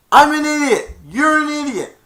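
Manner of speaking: high, loud shouted insult; shouting. Retold or imitated. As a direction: yell it out. Grinch yelling to echo.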